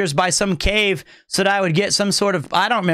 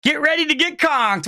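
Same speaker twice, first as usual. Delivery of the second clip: hyped; 7 semitones higher than usual. Real, not acted. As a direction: hype up the arrival. Hyped welcome to a mod.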